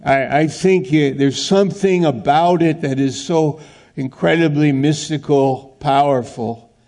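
ordinary talk, nothing marked

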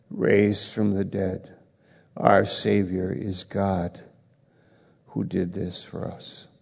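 quiet, slow sermon voice, letting each phrase sink in; hushed reverent preaching. Acted. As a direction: speak low and slow, like a solemn confession. Slow hushed opening about the Savior.

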